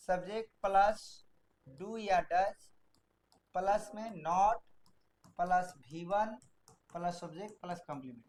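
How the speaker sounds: dictating the structure for students to write, slow and soft; slow dictation. Shown as a demonstration. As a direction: spell it out for note-taking. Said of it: "तो इसको लिख लेंगे".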